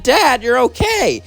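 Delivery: high, slow, drawn-out joyful cry; elated, high-pitched little daughter; overjoyed relief. Acted. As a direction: greet a dad you thought was lost. Nifty hugs father found alive.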